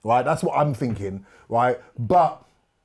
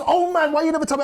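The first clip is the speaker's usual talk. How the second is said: much higher, fast exasperated outburst; 14 semitones higher, 3 dB louder, faster than usual. Retold or imitated. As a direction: blurt it out in dismay. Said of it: "He goes".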